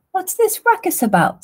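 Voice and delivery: high-pitched seagull squawk; high, querying bird voice. A narrator's reading. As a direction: squawk it like a nosy gull. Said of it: "squawked the seagull".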